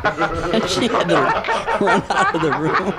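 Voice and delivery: cracking up mid-sentence, pitch soaring; laughing. Real, not acted. Teller breaks up at own joke, laughing. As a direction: laugh through the words.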